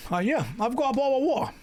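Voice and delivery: high, fast, hammed-up British "bottle of water" with dropped t's; mock-posh British, swallowing the t in "water"; exaggerated British accent. Shown as a demonstration. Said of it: "Don't take the piss out of my British accent saying it".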